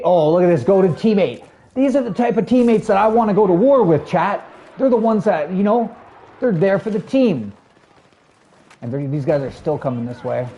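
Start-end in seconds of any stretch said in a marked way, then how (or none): 0.0-7.5 high-pitched, excited hype for a teammate; excited streamer bragging up a teammate; gushing praise. Real, not acted. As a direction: sing their praises loudly, pitched well up.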